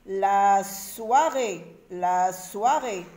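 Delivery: "La soirée" slow, each syllable stretched; slow sounding out. Shown as a demonstration. Teacher modelling reading for children, slowly stretched syllables. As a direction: draw out La, soi, rée for learners.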